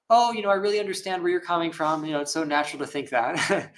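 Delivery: high, sugary reassurance, hammed up; mock gentle soothing. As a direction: coo it like a pushover comforter. Mock soothing, contrasted with Buddha.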